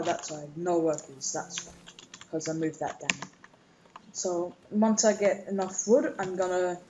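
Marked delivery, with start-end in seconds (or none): none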